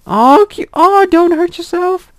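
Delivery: very high, slow gushing squeal; squealing over cute bunnies; cutesy squeal. Real, not acted. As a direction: melt over the bunnies out loud.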